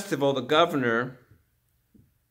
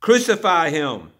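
loud, raised cry for condemnation; crowd's shout; 5 semitones higher, 9 dB louder than usual. A narrator's reading. Crowd demands death from Pilate, shouting. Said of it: "the crowd shouted".

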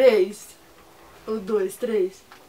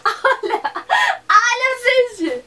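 high excited call; 10 semitones higher, 5 dB louder, much slower than usual. Real, not acted. Calling viewers excitedly.

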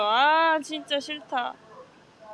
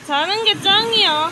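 higher, loud and excited; excited shout; 6 semitones higher, 8 dB louder than usual. Shown as a demonstration. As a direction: cheer it out loud. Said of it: "repeated line".

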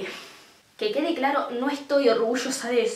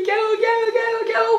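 frantic what-do-I-do, much higher; panicked; 9 semitones higher, faster than usual. Retold or imitated. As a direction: inner voice in full mock panic, shouting. Panicked inner voice while fleeing.

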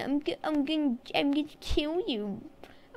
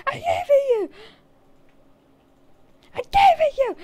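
higher, louder repeat; loud insistence; 12 semitones higher, 6 dB louder than usual. Shown as a demonstration. Player insisting to friend he gave the item, louder. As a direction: insist louder each time.

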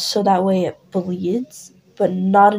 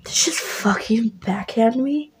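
lightly voiced, shocked then bitter friend; a hint of hurt turning to spite; stung friend [name]. [name] just got backhanded by his friend, bitter. [name] after being slapped.

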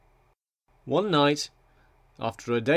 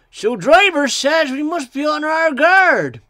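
high, loud, drawn-out warning; emphatic high-voiced engine; little engine voice; 13 semitones higher, 8 dB louder than usual. A narrator's reading. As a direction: announce it slowly in a high character voice. Engine Bill passes on warning.

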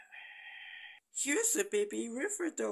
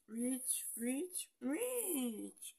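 usual speaking voice

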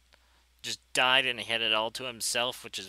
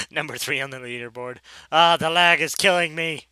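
loud character voice, climbing higher; mock complaint in a put-on voice, getting much louder; silly put-on voice; 5 semitones higher, 14 dB louder than usual. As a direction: blurt it in a goofy voice, building louder. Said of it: "why I said it in that voice".

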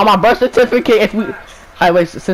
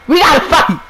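put-on much higher, a playful young fighter's voice; high mock kid voice; 9 semitones higher than usual. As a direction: squeak it out like an eager kid ready to brawl. Voicing the son eager to fight.

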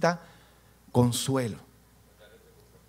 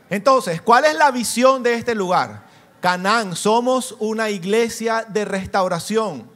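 fervent preaching; 6 semitones higher, 9 dB louder, slower than usual. Acted. Preacher proclaims church vision.

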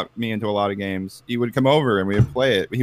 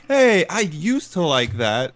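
slow, higher-pitched patronizing greeting; condescending; 5 semitones higher, slower than usual. Retold or imitated. Someone belittling a Newgrounds worker, slow condescending tone. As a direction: draw it out, leaning on "used". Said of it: "That's, like, the most condescending thing you can say".

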